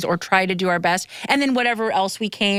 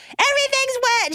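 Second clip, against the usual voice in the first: shrill high yelled complaint, pitched way up; mock whiny yell. Retelling cousin yelling about the wet bathroom, high mock whine. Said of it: "She's fucking yelling".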